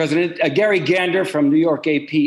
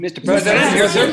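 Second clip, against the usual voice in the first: reporters shouting Mister President; clamoring reporters; 4 semitones higher than usual. Acted. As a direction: call out over the crowd.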